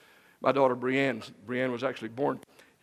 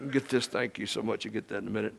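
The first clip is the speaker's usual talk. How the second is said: ordinary talk, nothing marked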